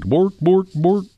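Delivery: bork it like the Muppet chef; Swedish chef voice. Retold or imitated. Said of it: "your Swedish accent".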